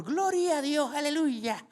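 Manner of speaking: high, bright churchwoman's exclaim; evangelical women praising. Retold or imitated. Father imitates the praying women hearing he is a pastor, high and joyful. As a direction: gush the praise like an excited church lady.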